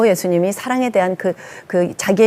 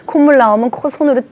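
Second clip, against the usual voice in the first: laughing through words; 4 semitones higher, 4 dB louder than usual. Real, not acted. Preacher laughing to the congregation about kids eating snot. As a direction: giggle out the gross detail.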